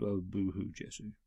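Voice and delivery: soft, low mock pity; mock sympathy. Host teasing the overworked hero, in mock pity. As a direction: mutter it with fake sympathy.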